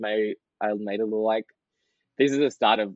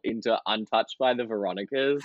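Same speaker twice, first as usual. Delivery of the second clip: ordinary delivery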